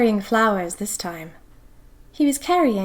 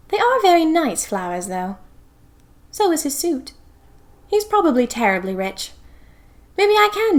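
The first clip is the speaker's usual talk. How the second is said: giddy admiration; 7 semitones higher than usual. A narrator's reading. Diarist gushing over flowers.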